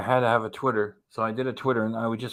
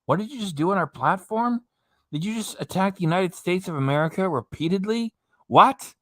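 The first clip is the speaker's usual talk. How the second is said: higher-pitched, incredulous questioning; indignant email; 7 semitones higher than usual. Acted. Streamer voicing YouTube's email to Chantel, mock indignant. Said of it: "they send her an email".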